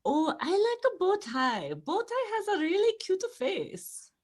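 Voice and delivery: high, gushing mom voice, bright and sweet. Retold or imitated. Mom gushing over her daughter's date, high and cooing. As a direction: coo it like a smitten mother. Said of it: "my mom was like".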